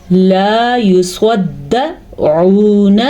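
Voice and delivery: لا یصدعون with the دال held doubled, very slow; doubled dal. Shown as a demonstration. Teacher models the correct shadda for students, slowly. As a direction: lean on the shadda in یصدعون, drawing each vowel out. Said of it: "اس شد کو ضرور آپ نے پڑھنا ہے".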